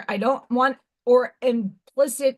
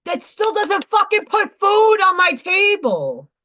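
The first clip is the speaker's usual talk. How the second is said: raised, higher, exasperated protest; real angry outburst; 6 semitones higher, 5 dB louder than usual. Real, not acted. Woman venting about no food on her table, angry and loud. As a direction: burst out louder, fed up.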